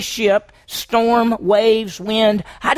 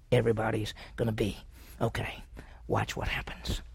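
hushed whisper; 9 semitones lower, 14 dB quieter than usual. Acted. Preacher's hushed suspense build.

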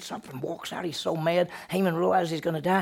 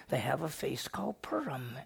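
a teacher's hushed Purim; whispered reveal; 2 semitones lower, 8 dB quieter than usual. Real, not acted. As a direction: whisper it like a secret.

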